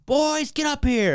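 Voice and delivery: high, drawn-out mock call; mom calling out. Mom summoning boys from the basement, calling out. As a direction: imitate a mom calling kids upstairs.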